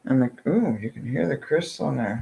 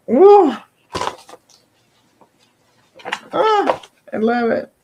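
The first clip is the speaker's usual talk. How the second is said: thrilled squeal; 13 semitones higher, 10 dB louder than usual. Real, not acted. Thrilled by unboxing gifts.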